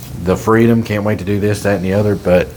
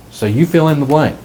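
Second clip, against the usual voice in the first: ordinary talk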